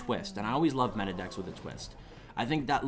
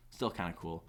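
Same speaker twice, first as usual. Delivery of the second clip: "Still kinda cool" said again lower and much softer; quiet echo; 7 semitones lower, 7 dB quieter than usual. Real, not acted. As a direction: mutter the repeat under your breath. Repeats phrase, second one fades.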